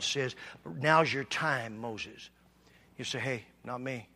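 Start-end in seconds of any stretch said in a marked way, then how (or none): none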